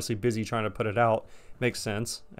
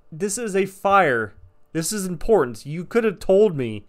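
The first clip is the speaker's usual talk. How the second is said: angry scolding; 7 semitones higher, 4 dB louder than usual. Retold or imitated. Scolding the coworker afterwards.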